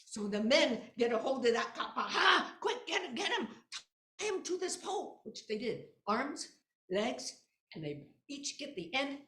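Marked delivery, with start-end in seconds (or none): 2.0-5.2 loud eager cries to grab him; excited villager shouts. Acted. Villagers shouting to grab the kappa, loud and eager. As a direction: call out to seize the creature.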